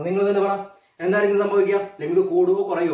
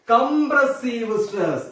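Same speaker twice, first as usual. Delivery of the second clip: Compressive stretched out very slowly; dictating slowly; 3 semitones higher, much slower than usual. Shown as a demonstration. Teacher introducing the new term, slow dictation. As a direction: say it slow so the class can write.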